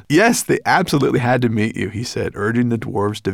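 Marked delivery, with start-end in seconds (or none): 0.1-1.9 put-on higher, loud, eager boy voice; laughing boy. A narrator's reading. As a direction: make an eager introduction. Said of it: "The boy laughed".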